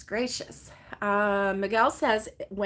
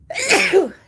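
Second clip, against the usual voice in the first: sneezing; 9 semitones higher, 11 dB louder than usual. Real, not acted. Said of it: "I need to sneeze again".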